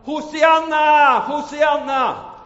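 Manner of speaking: high, loud, slow cry of Hosianna for help; desperate shout. Acted. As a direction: scream Hosianna as the ice breaks. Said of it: "Han ropar".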